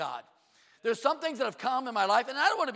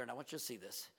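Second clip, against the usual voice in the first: ordinary talk, nothing marked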